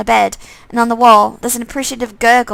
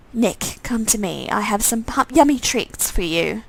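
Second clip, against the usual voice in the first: slow, much softer sinister coaxing; eerie luring voice; 4 dB quieter than usual. Acted. Undead twin luring the dog with treats, slow and soft. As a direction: lure the dog with a creepy calm.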